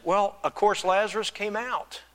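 ordinary talk, nothing marked

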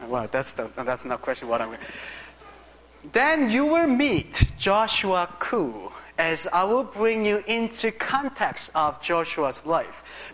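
usual speaking voice

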